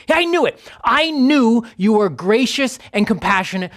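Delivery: high, indignant retort; so angry. Acted. Jonah answering God's question about his anger, angrily. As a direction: throw it back accusingly in mock anger. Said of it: "he's so angry".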